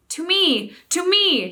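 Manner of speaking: calling out. A narrator's reading. King calling the few animals to him, loud call. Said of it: "he called".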